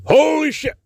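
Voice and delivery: sudden high startled yelp; surprised exclamation. Retold or imitated. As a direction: blurt it out in shock. Bus suddenly starts moving.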